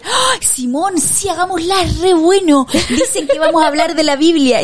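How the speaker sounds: put-on high, eager child's voice, laughing at the end; excited kid friend. Mom imitating her son's school friend, high excited child voice. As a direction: bubble over like an excited little boy.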